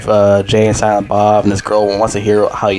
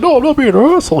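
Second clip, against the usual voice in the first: much higher, squeaky frightened protest; scared denial; 3 dB louder, faster than usual. Retold or imitated. Dante squeaks at the old man in a frightened, much higher voice. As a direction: shrink back and squeal it out, scared.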